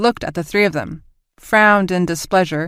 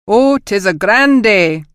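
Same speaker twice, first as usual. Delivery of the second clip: slow, loud, cheerful mock Scottish accent; hideous Scottish accent; 3 semitones higher, 6 dB louder, slower than usual. Officer joining the captain's joke in a terrible Scottish accent. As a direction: ham up an awful Scottish accent. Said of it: "his Scottish accent even more hideous than Emily's".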